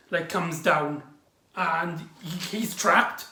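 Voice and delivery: usual speaking voice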